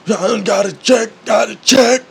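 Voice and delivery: high, loud hype chant; imitated yell. Mimicking gym guy's first yell, high chant. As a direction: psych yourself up like a crazed lifter. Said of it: "first yell".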